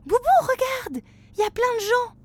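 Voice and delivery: put-on high, eager exclaiming child; excited child. A narrator's reading. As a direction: call out in wonder. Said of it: "s'exclame Flora". (enfant qui s'exclame, émerveillé)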